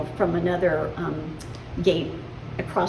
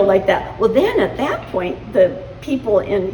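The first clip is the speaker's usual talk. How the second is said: usual speaking voice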